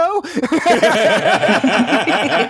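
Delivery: loud high laugh; big laugh. Real, not acted. Co-host cracking up at a good line, loud laughter. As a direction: burst out laughing.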